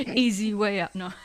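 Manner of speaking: words broken by laughter; laughing. Real, not acted. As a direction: read it through giggles. Laughs while reading line.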